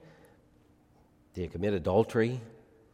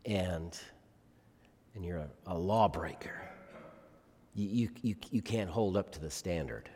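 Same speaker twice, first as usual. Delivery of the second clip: dropped to a near whisper, much softer; hushed accusation; 4 semitones lower, 8 dB quieter than usual. Acted. Preacher quietly confronting the congregation, near whisper. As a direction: say it softly and close, like a confession.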